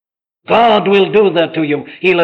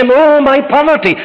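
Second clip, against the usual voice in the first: higher, louder lament; a higher, louder cry about poverty; anxious believer; 8 semitones higher, 5 dB louder than usual. Retold or imitated. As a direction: voice the fretful believer. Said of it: "You say".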